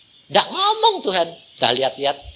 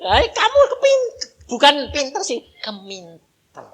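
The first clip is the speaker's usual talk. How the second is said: mocking jab; 8 semitones higher than usual. Mocking someone too clever.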